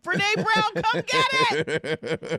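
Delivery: high, loud mock summons; playful calling out. Mock calling out to Brene Brown.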